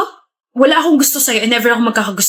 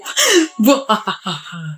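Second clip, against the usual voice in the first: hearty laugh; 2 semitones lower than usual. Acted. Friend laughing at the couple.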